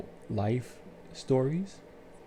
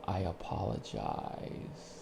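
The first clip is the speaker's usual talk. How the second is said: much lower, slow and soft; sincere apology; 12 semitones lower, 7 dB quieter, slower than usual. Shown as a demonstration. Owning a wrong with a calm sincere apology. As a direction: lay the apology down gently and sincerely. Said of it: "I always say".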